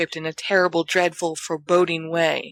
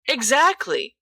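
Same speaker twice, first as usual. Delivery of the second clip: higher, falling echo of James; Sirius echoing; 8 semitones higher, slower than usual. Acted. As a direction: repeat it firmly, higher. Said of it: "Sirius echoes".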